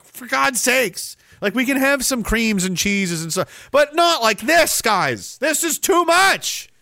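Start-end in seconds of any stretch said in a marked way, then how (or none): 0.0-1.2 higher and slow, fed up; exasperated groan. Retold or imitated. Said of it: "You're like". 3.7-6.7 high and slow, bursting into shouts; exasperated rant. Pretend. Ranting against sauces and creams.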